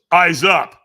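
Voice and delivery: sudden, loud coaching order; trainer's loud command. Retold or imitated. Trainer to nervous firewalker at the line, commanding.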